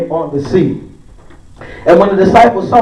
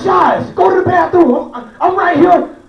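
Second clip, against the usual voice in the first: exasperated dad; 8 semitones higher than usual. Retold or imitated. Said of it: "I be like".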